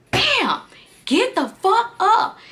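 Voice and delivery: high, drawn-out demand for him to get up; outraged high yell. Retold or imitated. To the sleeping boyfriend after finding the photos, an outraged high yell. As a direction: wake him with fury.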